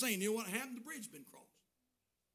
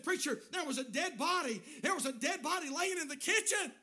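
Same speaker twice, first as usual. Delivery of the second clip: alarmed report; 9 semitones higher than usual. Retold or imitated. Retold alarmed report of a body.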